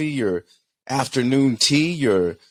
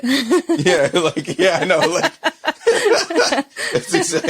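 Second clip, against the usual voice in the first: laughing; 12 semitones higher than usual. Real, not acted. Host cracks up at wife.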